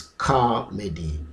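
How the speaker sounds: "comedy" said as KAH-medy, with an open "ah"; variant pronunciation. Shown as a demonstration. Teacher introducing the optional pronunciation, stressed "kah". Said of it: "optional pronunciation or another pronunciation".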